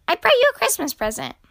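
high, quick, loud and eager; cheery. A narrator's reading. Squirrel brings Grumpy Badger a gift, cheerily. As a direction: announce it gladly at a lively clip. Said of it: "he said cheerily".

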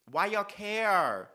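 drawn-out exasperation. Acted. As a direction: stretch it out in disbelief. Ranting at straight men.

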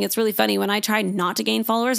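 normal talking voice

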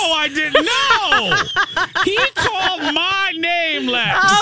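very high, indignant protest; hammed-up defensive wailing; mock outrage. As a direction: squeal your defense, drawing out key words. Mock quarrel over who got him.

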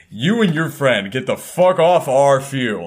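slow, menacing mock threat; hostile guy. Angry guy telling intruders to leave. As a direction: lay down the law to a stranger. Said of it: "He's like".

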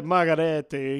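hammed-up Italian pronunciation; mock Italian, badly done; terrible Italian accent. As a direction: put on a bad Italian accent. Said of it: "I do a terrible Italian accent".

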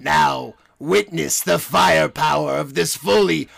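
Emperor Palpatine. Acted. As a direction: bellow it like an evil emperor. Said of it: "That's the first person you have to impersonate".

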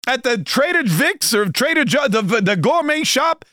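hammed-up old-man fury at syrup; high exaggerated Bernie impression; Bernie Sanders outrage. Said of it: "he goes".

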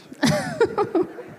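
very high short laugh; high squeaky real laugh. Real, not acted. Laughs after joke.

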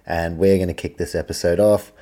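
ordinary talk, nothing marked

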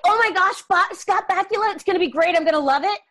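high, loud gush that settles gradually; mock-thrilled fan, starting high and easing down; gushing fan excitement. Fan gushing over a favorite actor joining the show, excited. Voices a fan's hopeful thought.

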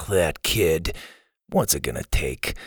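low, weary grumble after a sigh; fed up with a hopeless kid; exasperated. Acted. Counselor exasperated by defiant kid.

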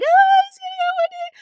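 very high, loud squeal of surprise; excited squeal. Retold or imitated. Seeing her boyfriend kneel, a high loud squeal. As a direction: squeal it like you can't believe it. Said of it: "I was like".

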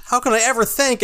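high gushing gratitude; overjoyed gush. Retold or imitated. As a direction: swoon with thanks. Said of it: "And Jake's like".

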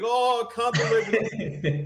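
high, drawn-out fake disbelief; disingenuous mock dismay. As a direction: imitate someone badly faking dismay, slow and high-pitched. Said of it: "a disingenuous job of like".

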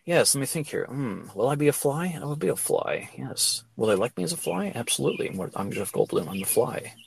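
hushed conversation with himself; soft musing, dropping low by the end; Jeff Goldblum. Acted. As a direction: ask and answer yourself in a quiet murmur. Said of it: "that's how he talks".

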